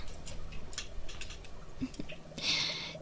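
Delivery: breathy little laugh; quiet unvoiced giggle; soft whispered chuckle. Monster chef chuckles softly.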